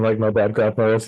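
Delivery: ordinary delivery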